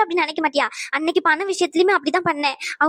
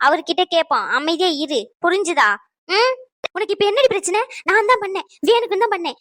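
normal talking voice

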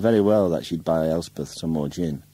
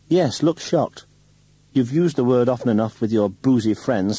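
loud, high, bitter outburst; a woman's scornful rant, pitched high and loud; rising fury; 7 semitones higher, 5 dB louder than usual. Acted. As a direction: lash out at him, voice climbing. Wife rages at husband.

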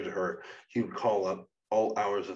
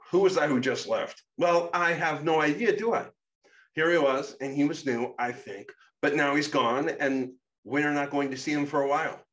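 higher, louder voice for the character Shadow; Shadow's voice; 6 semitones higher, 6 dB louder than usual. Acted. Shadow, irritated at the girl for asking, higher and louder. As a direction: pitch up and push the volume, playing Shadow.

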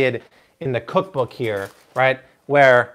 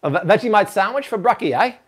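quick, higher mock-Aussie accent; Australian accent; 6 semitones higher, faster than usual. Shown as a demonstration. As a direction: toss it out in a put-on Australian accent. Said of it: "hear [name] do an Australian accent".

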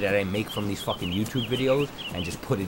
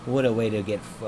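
normal talking voice

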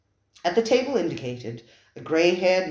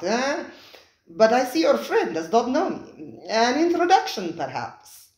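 effusive host; 7 semitones higher, 5 dB louder than usual. Acted. Spiro the restaurateur welcoming his diners, high and effusive. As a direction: gush over the guests like a fawning host.

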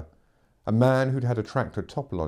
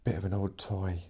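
much softer, muttered to himself; 7 dB quieter than usual. Acted. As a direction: talk yourself calm under your breath. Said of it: "he tried to tell himself".

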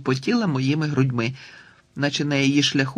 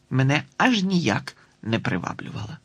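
ordinary delivery

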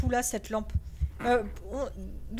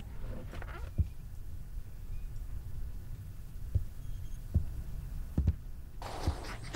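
sharp gasp; 6 semitones higher, 10 dB quieter than usual. Real, not acted. Startled by noise in horror game.

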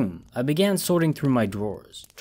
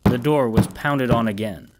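ordinary delivery, nothing marked

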